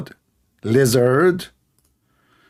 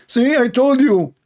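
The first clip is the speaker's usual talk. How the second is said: smug gloat; 11 semitones higher, slower than usual. Pretend. Gloating mock debater.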